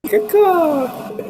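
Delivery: squawked high bird call; a silly crow squawk; bird caw. Pretend. Bird joke about flying in.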